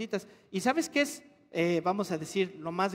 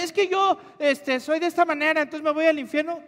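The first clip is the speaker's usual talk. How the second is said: mock worried questioner; 6 semitones higher, 3 dB louder than usual.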